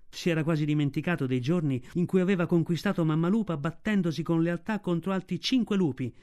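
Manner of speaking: plain reading voice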